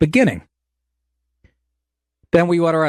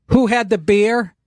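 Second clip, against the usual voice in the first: higher, slow mocking echo; mocking waitress; 8 semitones higher, slower than usual. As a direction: parrot her question mockingly. Mocking waitress's questions again.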